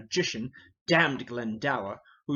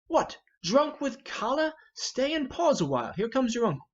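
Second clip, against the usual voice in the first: much higher, urgent and raised; alarmed check; 9 semitones higher than usual. Acted. Northumberland stopping his furious son, high and urgent. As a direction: pull him up short in alarm.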